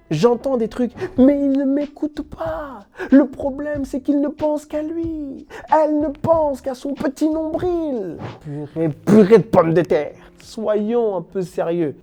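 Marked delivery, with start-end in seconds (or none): 0.1-8.2 high, loud mocking whine; whiny complaining partner. 8.4-10.3 loud. Real, not acted. Comic exclamation.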